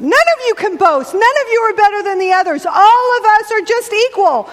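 normal talking voice

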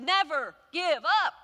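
a bigger, stronger Never give up. A narrator's reading. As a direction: raise the voice a notch as Churchill. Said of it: "in a little bit bigger voice".

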